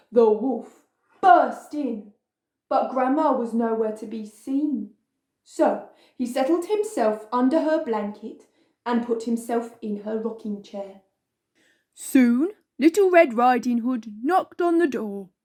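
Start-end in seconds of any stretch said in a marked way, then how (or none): none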